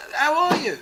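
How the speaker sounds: loud, high, hearty hello; eager greeting. Retold or imitated. Rick greeting Peter after years, loud and eager. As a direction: greet him like an old friend.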